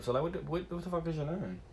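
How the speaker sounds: ordinary talk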